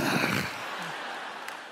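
a quick unvoiced laugh; breathy laugh. Real, not acted. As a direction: laugh softly at your own joke. Laughs at own joke.

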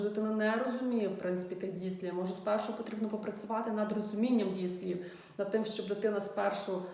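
normal talking voice

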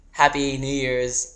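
usual speaking voice

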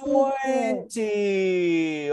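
loud cheerful call. Real, not acted. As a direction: call out the number to the class. Teacher calls out the answer.

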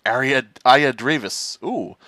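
ordinary talk, nothing marked

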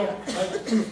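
very high, loud, laughing plea; hammed-up wheedling; whiny pleading. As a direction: beg with a fake pout. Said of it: "Y yo".

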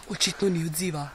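ordinary talk, nothing marked

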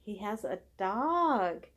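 plain reading voice

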